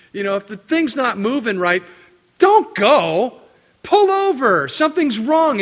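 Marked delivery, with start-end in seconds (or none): 2.4-5.6 loud, high, scolding commands; exasperated loud scolding; scolding shout. Retold or imitated. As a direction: bark it like you're scolding a careless kid. Scolding the reckless driver.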